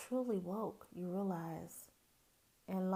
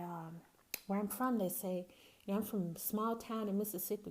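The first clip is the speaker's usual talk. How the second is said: normal talking voice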